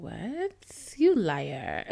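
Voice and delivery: mock outraged laughter; incredulous laughing retort. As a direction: scoff and laugh at the liar. Retorting to insulting guy, laughing.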